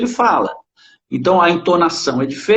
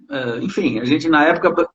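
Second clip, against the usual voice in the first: ordinary talk, nothing marked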